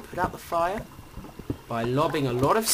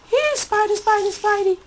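much higher, louder sing-song call; 10 dB louder than usual. Pretend. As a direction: call the spider like a pet. Calling the spider.

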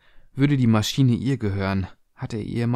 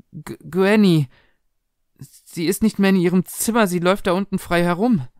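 high, louder stammered alarm; alarmed daughter; 7 semitones higher, 4 dB louder than usual. Acted. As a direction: blurt out the frightening news, pitched up. Frightened daughter reports granny loose.

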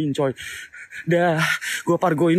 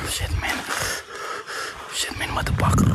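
frightened whispered curse; scared whisper; 5 dB quieter than usual. Real, not acted. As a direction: hush it out in fear.

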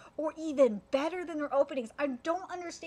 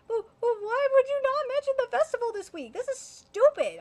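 higher, louder, whiny angry outburst; indignant yell; 10 semitones higher, 7 dB louder than usual. Real, not acted. Fan ranting at studio about the festival, indignant yell. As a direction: rant at the studio with real frustration.

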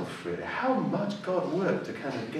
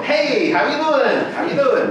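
loud, high, drawn-out American greeting; hammed-up wild American hollering hello; 13 semitones higher, 8 dB louder than usual. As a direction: put on an over-the-top American act. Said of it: "a wild American".

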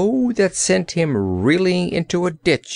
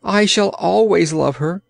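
higher-pitched avowal; ardent cry; 6 semitones higher than usual. A narrator's reading. Gardener's boy insisting on his love, crying out. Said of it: "cried Pon".

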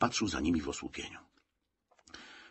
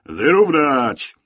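loud, stretched, high drill order; shouted command; 6 semitones higher, 10 dB louder, much slower than usual. A narrator's reading. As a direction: shout it at straggling soldiers. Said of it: "krzyknął pułkownik". (wykrzyczana komenda)

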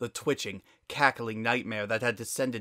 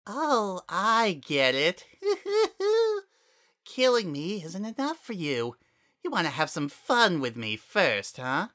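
sly cackling flower; 8 semitones higher than usual. Acted. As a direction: sneer knowingly.

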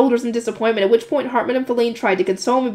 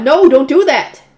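slow, loud no, don't do that; suppressed shout; 3 semitones higher, 7 dB louder, slower than usual. Acted. Girl wants to shout at her attendant, slow loud protest. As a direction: shout it out, drawn long. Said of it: "I wanted to shout".

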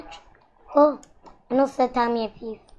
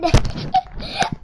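breathy giggle. Real, not acted. Laughing while telling story.

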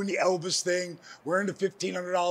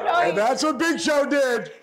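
high loud slow shout; excited shout; 8 semitones higher, 6 dB louder, slower than usual. Real, not acted. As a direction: hammer it home with a laugh. Laughing excitement at the punchline.

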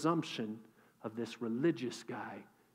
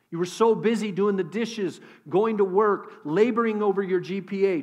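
louder, driving sermon delivery; full-force preaching; 5 semitones higher, 9 dB louder than usual. Acted. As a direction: press the warning home to the congregation.

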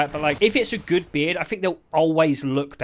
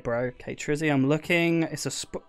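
ordinary talk

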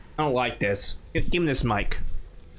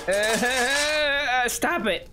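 high, wavering whine; fearful pleading; 7 semitones higher, much slower than usual. Real, not acted. Player pleading with scary noises, whining nervously. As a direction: whimper it as a nervous plea.

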